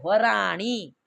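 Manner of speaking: वराणी stretched slowly, tongue curled back on णी; slow demonstration of the ṇ in वराणी; retroflex ण in वराणी. Shown as a demonstration. Teacher applies the rule for students, slow retroflex वराणी. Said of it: "आणी बोलना है".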